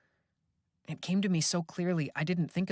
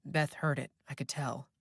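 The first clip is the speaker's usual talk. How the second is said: ordinary delivery, nothing marked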